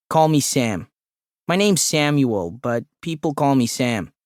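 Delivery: nasal-drawling office character, lightly puffed; chummy short man. A narrator's reading. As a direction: introduce yourself as an eager little colleague. Voices the short man Sam.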